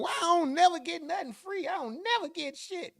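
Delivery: high, far whiny voice, complaining it is never his turn; whiny complaint. Pretend. Zentavian whining to the world that he never wins anything. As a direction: whine like you always lose out.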